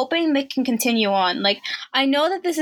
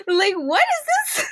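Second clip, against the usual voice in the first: baffled question; 8 semitones higher, slower than usual. Retold or imitated. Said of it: "And I'm like".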